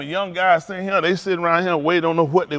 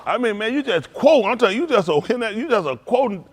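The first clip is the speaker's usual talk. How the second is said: ordinary delivery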